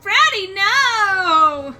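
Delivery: loud, high horrified cry; shocked shout. Acted. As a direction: yell it in mock horror. Horrified cry at friend's joke.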